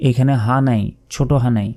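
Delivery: normal talking voice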